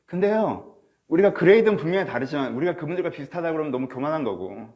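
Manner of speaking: ordinary delivery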